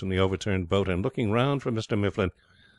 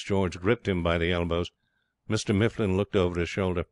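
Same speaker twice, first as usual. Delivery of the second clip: ordinary delivery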